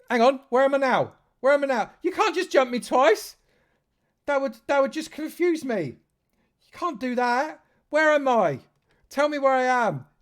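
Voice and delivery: high, loud, hammed-up confusion; mock-indignant whining; mock grump. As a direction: play-act a grumpy fuss. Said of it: "I'm having a grump".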